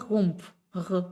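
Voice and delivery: a lone R gargled in the throat; throaty German R. Shown as a demonstration. Teacher isolates the German R sound, gargled. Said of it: "Y es diferente a la R".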